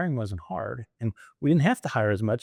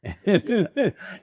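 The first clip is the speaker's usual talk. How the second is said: high bright laugh; real laugh; 9 semitones higher than usual. Real, not acted. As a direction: laugh at your own punchline. Laughs at own punchline.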